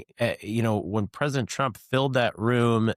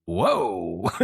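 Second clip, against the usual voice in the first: a lightly voiced, startled whoa; hint of amazed Trump; mock surprised whoa; 2 semitones lower than usual. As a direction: imitate a surprised speaker reacting to cheers. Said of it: "And he goes".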